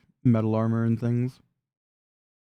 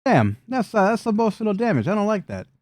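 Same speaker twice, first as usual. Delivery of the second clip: high mock-hurt goblin voice; comically hurt, pitched up; wounded goblin brute; 9 semitones higher than usual. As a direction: take offence in a high character voice. GM answers as the insulted brute.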